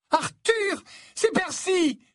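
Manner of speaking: very high, loud, excited call; overwrought cry. A narrator's reading. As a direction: cry out the astonishing news to your husband. Mother spots her son, calls husband. (cri aigu, tout ému)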